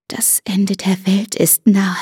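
slow, fateful whisper; ominous whisper. Acted. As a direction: whisper it like a prophecy. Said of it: "Ihre geflüsterten Worte klangen schicksalsschwer".

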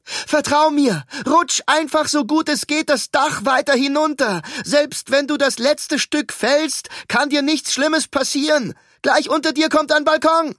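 loud high reassuring call. Acted. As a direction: urge her down, calling up high. Ruben calls instructions up. (beruhigend nach oben gerufen)

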